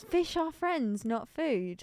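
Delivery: mild Nemo character voice; quoting Nemo. Retold or imitated. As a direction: draw the Nemo line out as a cartoon quote. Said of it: "From Nemo".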